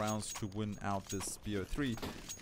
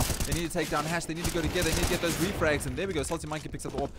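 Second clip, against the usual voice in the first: higher, louder esports caster; raised live-commentary energy; urgent play-by-play; 6 semitones higher, 6 dB louder, faster than usual. Acted. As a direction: press the team's need with urgency. Calls urgent team play.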